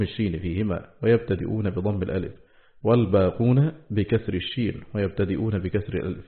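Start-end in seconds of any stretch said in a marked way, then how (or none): none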